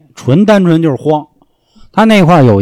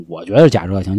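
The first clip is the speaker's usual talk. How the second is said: ordinary talk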